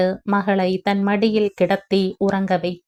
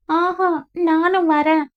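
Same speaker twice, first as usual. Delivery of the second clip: put-on stubborn whining child; 9 semitones higher than usual. Frightened Anuja insisting to her mother, high childish whine. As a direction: whine it like a child refusing to stay. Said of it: "அடம் பிடித்தவளிடம்".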